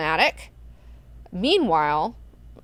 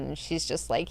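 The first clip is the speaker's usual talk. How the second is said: normal talking voice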